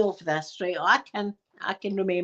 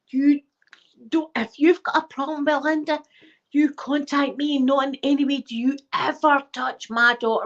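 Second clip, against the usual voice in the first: higher, firm telling-off; mum going for it; 6 semitones higher than usual. Retold or imitated. Mum confronting the teacher, firm higher telling-off. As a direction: lay down the law for your child. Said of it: "my mum's out there going".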